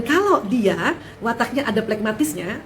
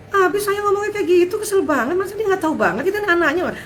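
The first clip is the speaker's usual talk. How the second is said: put-on complaining child; 9 semitones higher than usual. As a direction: whine like a child annoyed at dad. Anak menumpahkan kekesalan. (anak mengeluh kesal, nada tinggi)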